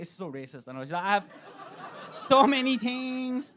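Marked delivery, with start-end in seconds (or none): 1.0-3.5 slow drawn-out higher vendor voice, laughing; high slow Thai stall lady; ladyboy voice. Retold or imitated. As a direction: stretch out the words like the vendor. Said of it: "straight for a ladyboy voice".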